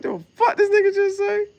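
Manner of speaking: incredulous high squeal. Retold or imitated. Retelling his reaction to weird slang, high incredulous squeal. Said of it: "talking like Pootie Tang".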